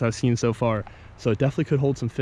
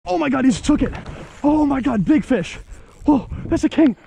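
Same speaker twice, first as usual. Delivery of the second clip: high squealing shouts of joy; shrieking excitement; 6 semitones higher than usual. Real, not acted. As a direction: scream with thrill at a big catch. Fish strikes, real excitement.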